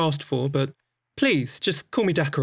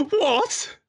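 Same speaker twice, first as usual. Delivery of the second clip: sharp, much higher gasped what; gasp, then ask in disbelief; shocked gasp and a high what; gasp of surprise. Acted. Shocked at the bad impression.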